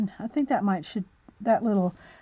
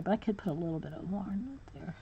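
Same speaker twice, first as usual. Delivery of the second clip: much softer, breathy aside to herself; whisper; 2 semitones lower, 14 dB quieter than usual. Real, not acted. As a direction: whisper it while concentrating. Drops to a whisper while coloring.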